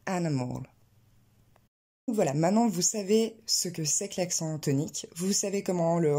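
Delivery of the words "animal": ANIMAL as AN-uh-mol, stressed on the first syllable; model for repetition. Shown as a demonstration. Teacher letting learners repeat after her, correctly stressed animal. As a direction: lean on the first A of ANIMAL, lower pitch. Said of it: "je vous laisse répéter derrière moi".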